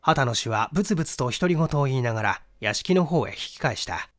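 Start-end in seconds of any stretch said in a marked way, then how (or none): none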